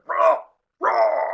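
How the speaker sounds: loud retching gag noise; vomiting. Shown as a demonstration. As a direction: fake a heaving retch, loud and high. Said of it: "my imitation of somebody vomiting".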